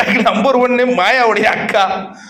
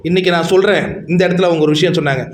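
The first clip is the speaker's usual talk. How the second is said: ordinary talk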